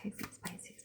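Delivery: hushed whisper to the cards. Real, not acted. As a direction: whisper it under your breath. Whispering while shuffling cards.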